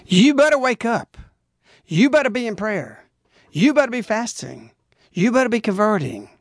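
pleading. Acted. Preacher pleading with listeners to pray and fast, urgent. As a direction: beg them to wake up. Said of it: "in a pleading way".

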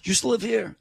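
put-on high, broad New York fireman accent; a touch of tough New York cop; retold New York fireman, light accent. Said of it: "in this heavy New York accent".

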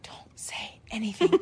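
hushed whisper, keeping it secret. Retold or imitated. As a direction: whisper so he can't hear. Whispering so husband won't hear.

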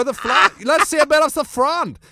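high, loud camp Austrian insistence. Retold or imitated. Bruno pressing the wrestlers, high camp insistence.

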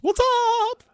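very high, falsetto what's up; hammed-up Beatrice greeting. Host greeting in his new name, high campy falsetto. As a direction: squeal a campy hello as Beatrice.

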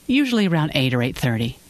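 ordinary delivery

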